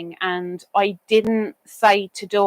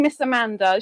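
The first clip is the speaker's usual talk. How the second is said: ordinary delivery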